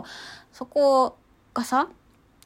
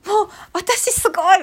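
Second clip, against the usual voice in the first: high, loud happy cry out over the river; joyful shout; 11 semitones higher, 6 dB louder than usual. Retold or imitated. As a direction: shout it out in delight, much higher and louder. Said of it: "叫んじゃうの". (嬉しそうに叫ぶ)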